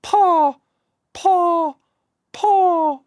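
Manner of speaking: hammed-up English accent, three identical drawn-out words; mock English accent. Shown as a demonstration. Scot mocking English speakers' identical words, exaggerated English accent. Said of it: "say those three words in an English accent".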